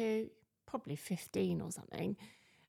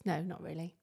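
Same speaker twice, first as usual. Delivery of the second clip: ordinary talk, nothing marked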